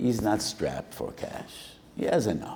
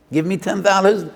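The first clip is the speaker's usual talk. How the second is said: high, loud "Give me ten dollars"; loud acceptance; 11 semitones higher, 8 dB louder, faster than usual. Acted. Lender accepting the small offer, loud and high. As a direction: stress the offer loudly.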